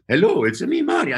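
high Mario voice. Retold or imitated. Second take of the Mario greeting, high. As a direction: pitch way up on Mario. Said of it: "He goes".

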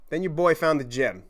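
usual speaking voice

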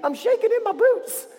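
very high, squeaky mock-scared voice; scared Israelites. Shown as a demonstration. Storyteller teaches kids the Israelites' line, mock terror. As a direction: quail in a comic falsetto. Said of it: "they were so scared".

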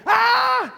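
high, loud short scream; mimicked child's scream; imitated piercing scream. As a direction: let out a sudden piercing cry like a frightened child. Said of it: "this scream ... ear-piercing".

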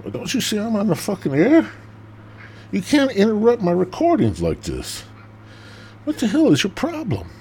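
angry cat voice. Pretend. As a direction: scold like an offended cat. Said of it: "yelling at me".

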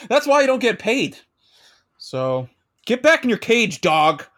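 loud, high barking at an underling; barking tyrant boss. Pretend. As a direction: bark orders at the intern.

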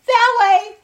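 high, loud hyena shout. Acted. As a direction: call out the direction as an excitable hyena.

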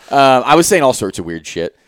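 normal talking voice

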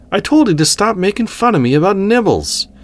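very high, fed-up protest; exasperated protest. A narrator's reading. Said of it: "for what must have been the thousandth time".

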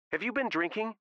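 high-pitched, indignant demand; sharp high accusation; accusing question. A narrator's reading. Sarah accusing Joe on the phone.

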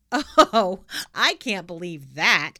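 loud, slow mock disbelief; Oh, hammed up in disbelief. A narrator's reading. Pippi to the teacher, loudly pretending not to believe.

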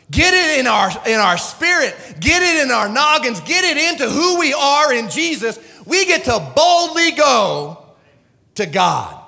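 loud, high, driving exhortation; impassioned preaching. Real, not acted. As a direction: drive it home at full preaching force. Preacher urging congregation at full force.

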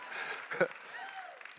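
short voiceless laugh; amused laugh. Real, not acted. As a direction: laugh off your own singing. Laughs after stopping song.

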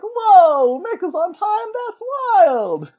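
very high, wowed exclamation; hammed-up astonishment; mock amazement. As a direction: squeal it like wowed little mice. Townsfolk mice amazed in the story.

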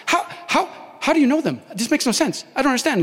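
high astonished questions; startled disbelief. Retold or imitated. Startled woman asking how he knows them, high and shocked. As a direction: voice jumps up in shock.

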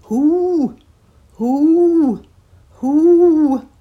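high, loud hooting owl; loud high owl cries; mournful owl hoot. A narrator's reading. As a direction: call out long like an owl in the dark. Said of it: "a mournful voice cried".

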